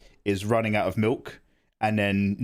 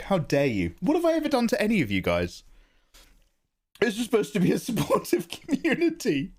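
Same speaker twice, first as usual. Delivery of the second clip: high, fast mock indignation; mock outrage; 10 semitones higher than usual. Real, not acted. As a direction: play hurt at the chat.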